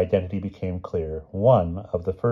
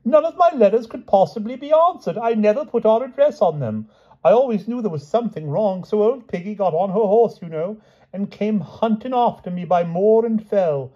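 high, gushing storytelling; King Pellinore in high delight; 4 dB louder than usual. A narrator's reading. King tells how she hunted him, in high delight. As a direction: babble happily with excitement. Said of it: "went on the king in high delight".

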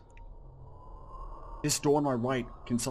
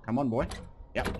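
ordinary talk

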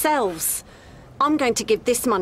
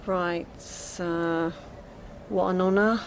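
sarcastic; 4 semitones lower, 5 dB quieter, slower than usual. Acted. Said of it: "that sounded a little sarcastic".

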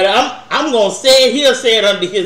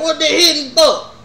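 higher, laughing, mock uncle; laughing uncle quote; 7 semitones higher, 4 dB louder than usual. Teller quotes her uncle at dice, higher and laughing. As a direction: ask it like the old uncle.

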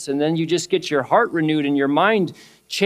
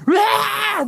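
a sudden high-pitched scream, sharply raised. Retold or imitated. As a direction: let out a piercing shriek of alarm. Retelling man fleeing, screaming.

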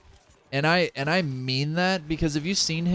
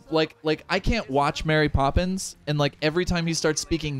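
ordinary delivery

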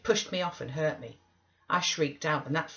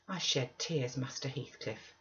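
usual speaking voice

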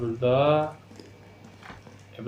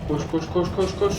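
being chased; 7 semitones higher than usual. Real, not acted. Fleeing zombies in game.

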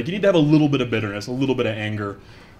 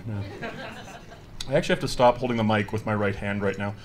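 ordinary talk, nothing marked

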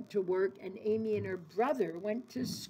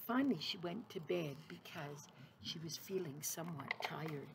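whispered, softer and lower, drifting off; sleepy whisper; 4 semitones lower, 11 dB quieter than usual. A narrator's reading. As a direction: breathe it out like someone falling asleep. Tired Amy going to bed.

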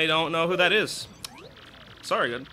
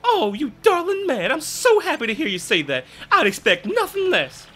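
louder, exclaiming genteel lady; prim lady voice; 11 semitones higher, 5 dB louder than usual. Acted. Gamer voicing a genteel lady gasping at the ring, prim. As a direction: gasp it like a fussy southern belle.

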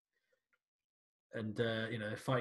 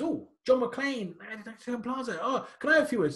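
eager reporter; 8 semitones higher, 4 dB louder than usual. Retold or imitated. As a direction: gush at him like a pushy reporter. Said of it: "sees McClane and goes".

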